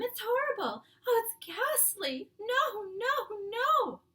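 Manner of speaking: high, loud, slow anguished pleading; nightmare pleading. Acted. The Queen dreams of giants, loud high pleading. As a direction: beg someone to stop a horror in your sleep. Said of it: "a slightly blurred sleep talker's voice".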